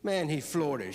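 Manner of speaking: usual speaking voice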